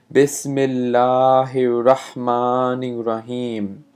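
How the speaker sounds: chanted recitation; Quran recitation. Acted. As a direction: recite it like in prayer. Recites the basmala.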